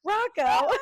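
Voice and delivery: high, loud cartoon squeak; squeaky character voice. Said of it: "He goes".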